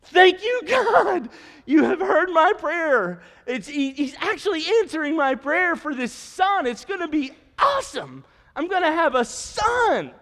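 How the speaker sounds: high, loud, jubilant shouting; overjoyed father-to-be shouting thanks to God; ecstatic shout. Retold or imitated. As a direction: shout for joy at answered prayer. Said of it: "his response would have been".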